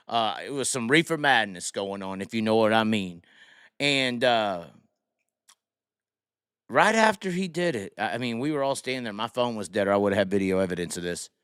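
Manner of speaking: ordinary delivery, nothing marked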